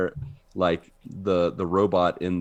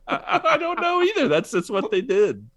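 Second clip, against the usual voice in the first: high, laughing disbelief. Real, not acted. Laughing about the hairnet.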